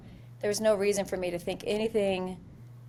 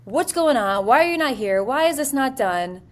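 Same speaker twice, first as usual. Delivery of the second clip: raised, higher-pitched angry questions; going off; 5 semitones higher, 5 dB louder than usual. Retold or imitated. Sergeant berating her lead over the phone, raised and angry. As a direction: fire the questions at him, no pause. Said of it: "And I just go off".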